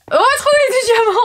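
high, loud gleeful cry; excited. Real, not acted. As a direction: cheer it out. Gleeful call while rushing.

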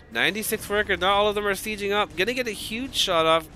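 caster getting carried away by the big shot; excited casting. Real, not acted. Caster hyped by big tank shot.

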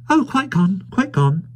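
high woman's voice, dropping on the repeat; flustered little wife. Acted. Wife waving Tackleton hurriedly away, high and flustered. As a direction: brush it off in a high voice.

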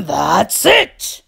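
loud, fed-up snarl. A narrator's reading. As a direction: snap that patience has run out. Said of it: "Theodore snarled".

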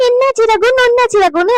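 high-pitched mocking mimicry; sing-song imitation of a crying wife; mock weepy wife. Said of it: "அழுகுற சீனை போட்டுருவீங்க".

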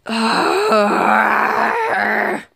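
loud wailing despair sound; a crying shout of defeat; anguished cry. Acted. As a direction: howl out in despair. Sonic despairs over his games.

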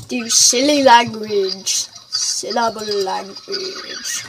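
slow, drawn-out silly voice. Pretend. Player joking about creatures' language, in a silly voice. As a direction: stretch the words out in a daft voice. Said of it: "Don't know why I just said it in that voice".

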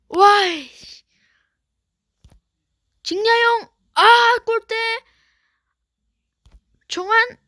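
high loud shouts at the game; frustrated shouting. Real, not acted. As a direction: yell at the screen. Player shouts during match.

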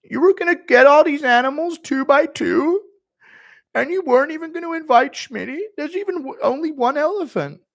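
high, loud whiny outrage; Schmitty impression. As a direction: squeal the betrayal. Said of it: "Not being invited on Noah's Ark".

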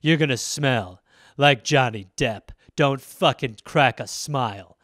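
deadpan fashion gravity, slow; mock serious perfume ad. Mocking fashion people's perfume ad, low slow solemn voice. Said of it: "people are just like".

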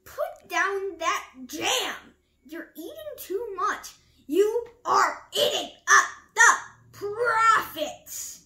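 loud, high, emphatic scolding; Rabbit crying out. A narrator's reading. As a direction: shout the order, building to heavy word-by-word stress. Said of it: "cried Rabbit".

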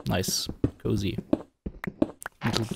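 soft whisper; whispered cozy. Real, not acted. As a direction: hush it, cozy and gentle. Describing cozy roof.

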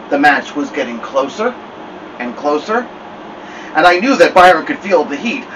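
ordinary delivery

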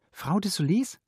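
high questioning call; high-pitched, restrained call; repeated call. A narrator's reading. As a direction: call out into the flat, hoping for an answer. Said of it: "rief sie noch einmal".

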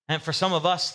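normal talking voice

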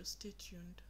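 normal talking voice

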